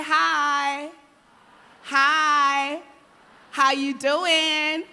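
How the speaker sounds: big, loud, slow hello to the crowd; loud friendly greeting. Shown as a demonstration. Speaker leads the audience in greeting the person beside them, loud and slow. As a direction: greet your neighbour loudly and slowly.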